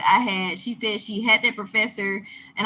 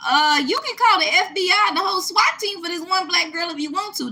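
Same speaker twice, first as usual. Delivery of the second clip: high, louder indignant snap at the teacher; snapping back; 10 semitones higher, 5 dB louder than usual. Retold or imitated. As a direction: fire it back, fed up and sarcastic. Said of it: "That's when I snapped".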